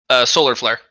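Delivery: ordinary delivery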